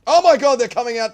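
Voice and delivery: sudden loud gasp of disbelief; shocked outburst. Real, not acted. Streamer stunned as enemy opens the gates, loud shocked outburst.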